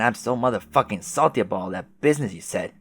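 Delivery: usual speaking voice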